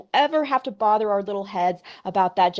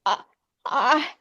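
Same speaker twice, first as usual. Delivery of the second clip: broken high stammer through tears; sobbing; 7 semitones higher than usual. Acted. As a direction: choke on each word. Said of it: "sobbed Missus Phelps".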